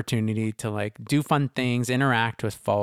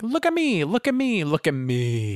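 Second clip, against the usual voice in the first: high, whiny look-at-me voice; mock a show-off begging for attention; mocking attention-seeker; 6 semitones higher than usual. Mocking ego-driven person.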